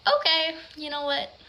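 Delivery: loud, high "okay" in mock exasperation; fed-up retort. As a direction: act out an exasperated comeback. Fed-up retort to his text.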